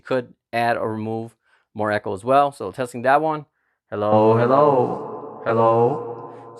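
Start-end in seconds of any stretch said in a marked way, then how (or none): 3.9-6.6 big hall reverb; concert hall reverb. Shown as a demonstration. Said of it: "big concert hall".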